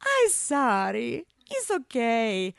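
put-on slow, lifted, accented consolation; tender foreign-accented apology; kindly apology. A narrator's reading. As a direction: comfort her with drawn-out words. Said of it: "he murmured kindly".

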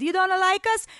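high, wounded question; hurt, rising accented appeal; pleading. A narrator's reading. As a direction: plead like a rejected foreign lover. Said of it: "Ivan pleaded".

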